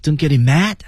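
high, pinched throat squeak; squeezed warning voice. Shown as a demonstration. Coach demonstrates the squeezed warning.

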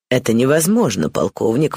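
ordinary delivery, nothing marked

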